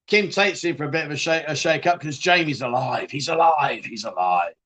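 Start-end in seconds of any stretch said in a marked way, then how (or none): 3.9-4.5 dramatic horror voice. Shown as a demonstration. Host retelling the soap twist, low spooky "He's alive".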